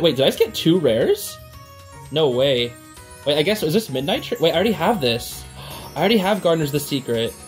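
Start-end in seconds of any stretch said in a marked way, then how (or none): none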